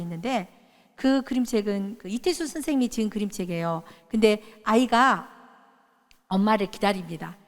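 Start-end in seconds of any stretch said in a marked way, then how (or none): none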